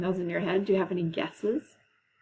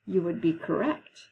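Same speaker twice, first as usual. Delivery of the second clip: usual speaking voice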